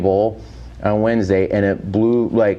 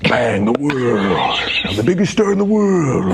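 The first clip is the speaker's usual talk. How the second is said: Mickey Rooney; 2 semitones higher than usual. Retold or imitated. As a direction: ham it up like a boastful faded star. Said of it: "see him do Mickey Rooney".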